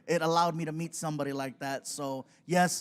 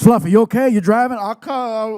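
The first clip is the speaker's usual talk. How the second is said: loud rough concern; gruff shout; 4 semitones higher, 13 dB louder than usual. Retold or imitated. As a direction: check on him like a tough guy. Stone Cold concerned.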